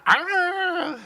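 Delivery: high sharp yelp, imitating the game; a sudden piercing "Ah!"; imitated scream. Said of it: "the noise was, was good".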